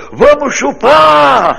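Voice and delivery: high, loud, drawn-out yell from the crowd. Retold or imitated. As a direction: bellow it out across the circus. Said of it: "um filho da puta gritou".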